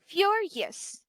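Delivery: "furious" high on FU, falling away after; first-syllable stress. Shown as a demonstration. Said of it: "The primary syllables is in the first syllable".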